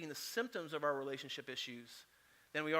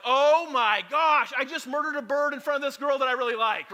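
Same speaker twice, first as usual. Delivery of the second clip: hammed-up panic at what he just did; horrified panic; 7 semitones higher, 12 dB louder than usual. Pastor reliving killing a bird in front of his date, loud, high mock horror.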